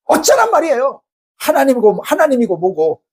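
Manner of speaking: high-pitched opening, settling as it goes; defiant outburst. Acted. Jonah talking back to God, defiant outburst. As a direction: talk back hotly, fed up. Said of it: "하나님 앞에 막 대들고 있는 거예요".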